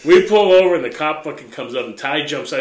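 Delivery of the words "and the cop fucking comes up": ordinary talk, nothing marked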